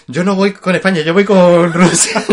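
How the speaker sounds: cheeky declaration, laughing at the end; mock defiant quote. Quoting ETA characters joking.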